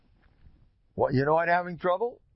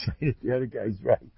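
normal talking voice

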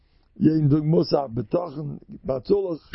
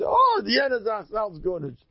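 dismissive; 6 semitones higher than usual. Retold or imitated. Acts out caller dismissing the emergency.

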